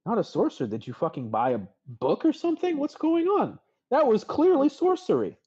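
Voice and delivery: rising to high, loud disbelief; scoff, then climb into disbelief; incredulous friend. Acted. Bonnie disbelieving Podzin's denial at the bar, incredulous.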